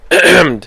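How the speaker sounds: loud ahem dropped mid-sentence; pointed throat-clear. Shown as a demonstration. Fan explaining his jab at Chelsea, pointed ahem. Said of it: "you know why I'd".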